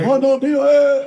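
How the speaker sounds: a burst of laughing teasing; laughing tease. Real, not acted. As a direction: call him out while cracking up. Teasing shout while laughing.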